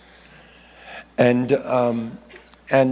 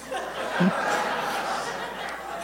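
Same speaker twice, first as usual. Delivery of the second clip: breathy laugh; 8 dB quieter than usual. Real, not acted. Laughs at his own slip.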